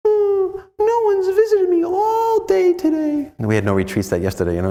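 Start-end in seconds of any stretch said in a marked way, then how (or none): 0.1-3.3 very high, tiny puppet-show voice; squeaky puppet Jesus. Retold or imitated. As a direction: voice a little whining Jesus like a hand puppet. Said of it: "I got a whining Jesus".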